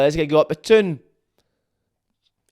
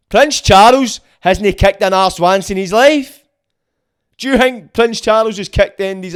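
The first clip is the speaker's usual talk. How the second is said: scornful emphasis; 6 semitones higher, 8 dB louder than usual. Real, not acted. Scornful rant about Prince Charles.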